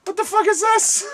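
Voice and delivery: high-pitched mock shock; acted amazement. Host retelling walking into the con, astonished. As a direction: shoot the pitch way up in disbelief. Acting out his amazed reaction.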